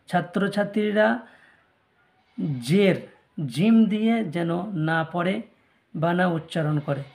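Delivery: ordinary talk, nothing marked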